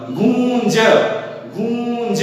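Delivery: गूंज drawn out as "goooon-ja"; open-mouthed गूंज. Shown as a demonstration. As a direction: stretch the nasal vowel of गूंज, mouth open, then land on ज. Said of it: "मुख पूरा खुला रखना पड़ेगा".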